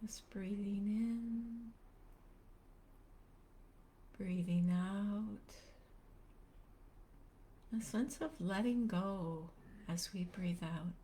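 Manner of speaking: unhurried meditation guide, long pauses between phrases; calm, spacious guiding voice; guided meditation. Real, not acted. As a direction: gently lead listeners into stillness, leaving space after each phrase.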